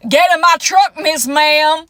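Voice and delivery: forceful mock Southern come-on; hammed-up Southern American accent. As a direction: play a confident Southern man in an exaggerated accent. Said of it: "has a southern accent to me".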